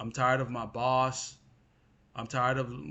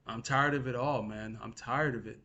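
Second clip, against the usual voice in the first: ordinary talk, nothing marked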